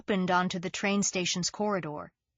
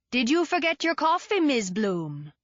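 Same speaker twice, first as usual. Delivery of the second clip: higher, lilting West Indian question; lilting West Indian accent; 5 semitones higher, 3 dB louder than usual. A narrator's reading. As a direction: lift it in a West Indian lilt. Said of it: "in his lilting accent".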